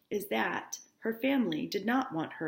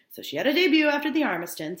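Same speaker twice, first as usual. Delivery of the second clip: higher and louder, faster retake; emphatic retake; 8 semitones higher, 9 dB louder, faster than usual. Shown as a demonstration. Teacher repeating the debut line, now emphatic. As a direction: punch the repeated line with energy. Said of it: "repeated line".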